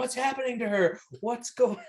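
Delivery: high-pitched retold cry of alarm; mock shouting. Imitating Arnold watching the girl melt, high mock shout. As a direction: call out in hammed-up panic. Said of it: "Arnold is shouting".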